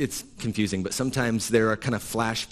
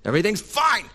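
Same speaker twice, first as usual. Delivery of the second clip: forced cheerful insistence; 5 semitones higher than usual. Retold or imitated. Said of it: "religious leaders are insistent".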